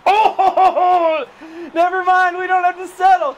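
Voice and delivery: real ecstatic screaming celebration; screaming with joy. Real, not acted. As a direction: whoop it up over the big home run. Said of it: "everybody heard me screaming".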